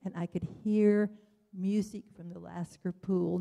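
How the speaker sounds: ordinary delivery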